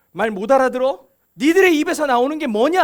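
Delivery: imitated scolding. Jesus confronting the disciples, loud scolding questions. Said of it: "또 지가 혼나죠".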